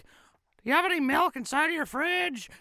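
high squeaky cartoon beggar voice; high-pitched cartoon mouse asking; Mickey Mouse. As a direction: plead in a high falsetto. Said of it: "like he's homeless. He's like".

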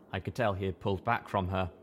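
usual speaking voice

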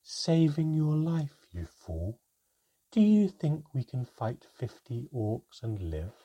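lightly voiced cool rebuke; calm but cutting, to a fool; Manka's scolding. A narrator's reading.

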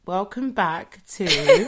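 laughing. Real, not acted. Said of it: "restarting the intro".

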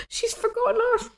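very high, whimpering little-kid imitation; mock deflated. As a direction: whimper it like crushed kids, hammed up. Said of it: "deflated look as if to go".

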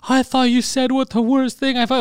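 high, flustered voice acting out panic; mock freak-out. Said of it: "he's freaking out".

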